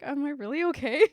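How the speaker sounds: a touch of fear, voice rising; scared. Retold or imitated. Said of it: "shaking and scared".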